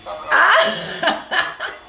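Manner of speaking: laughing. Real, not acted. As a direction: laugh out the memory as you say it. Laughs while telling story.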